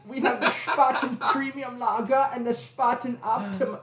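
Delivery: high laughing mock German accent. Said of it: "do it in your creepy German accent".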